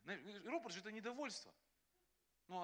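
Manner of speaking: normal talking voice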